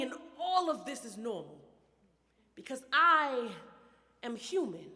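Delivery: intense, rhythmic poetry performance; spoken word poetry. Acted. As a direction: perform it like a slam poet.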